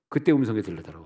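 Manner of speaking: ordinary delivery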